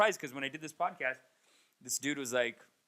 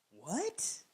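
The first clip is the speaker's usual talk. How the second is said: stunned what; 5 semitones higher, 6 dB quieter than usual. Real, not acted. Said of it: "reaction to being called extreme".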